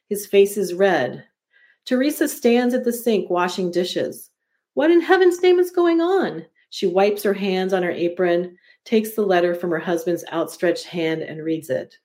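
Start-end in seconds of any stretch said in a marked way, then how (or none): 4.7-6.5 high, alarmed cry. A narrator's reading. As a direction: blurt it out in alarm. Wife faced with shocking letter.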